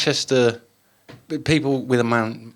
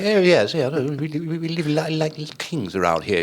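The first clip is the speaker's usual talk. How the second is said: hammed-up posh Cambridge toff; posh Cambridge accent; 6 semitones higher than usual. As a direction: stammer it out in a mock posh accent. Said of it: "everyone's really posh".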